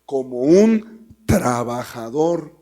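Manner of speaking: slow, loud, drawn out como un trabajador; stress on un trabajador. Shown as a demonstration. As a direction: lean hard on un trabajador, stretched. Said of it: "otra vez lo leo".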